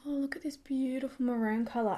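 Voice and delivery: soft, whispery aside; breathed softly while busy with make-up; hushed admiration. Real, not acted. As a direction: half-whisper in admiration of the colour. Murmured aside while applying make-up.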